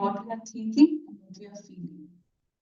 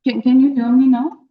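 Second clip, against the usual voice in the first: clearly louder, projecting to the mic; 2 semitones higher, 8 dB louder than usual. Real, not acted. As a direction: push the voice out more. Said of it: "try to increase the volume".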